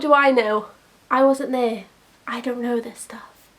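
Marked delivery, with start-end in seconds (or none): 2.3-3.5 whisper. Real, not acted. Whispered aside after disclaimer.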